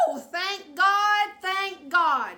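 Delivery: high, loud whoop of relief; whoop, then holler it out; imitating husband's holler. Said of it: "Do it like you did it".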